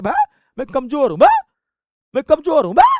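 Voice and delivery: high, loud, incredulous outburst; mock disbelief. Teacher mimics a weak student told of his weakness, mock disbelief.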